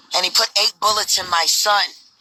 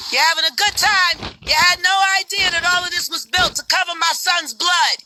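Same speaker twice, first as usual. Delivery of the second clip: high-pitched bitter accusation; furious grieving mother; 10 semitones higher than usual. Acted. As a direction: accuse them with rising pain. Mother confronts people at site.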